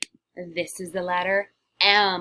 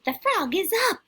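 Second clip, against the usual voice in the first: much higher, louder witch line; 12 semitones higher, 4 dB louder, faster than usual. Shown as a demonstration. Witch models a sentence for the class, higher and louder. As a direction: squawk it like a little witch.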